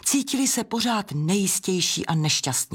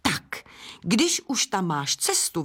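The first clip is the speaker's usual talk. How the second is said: ordinary delivery, nothing marked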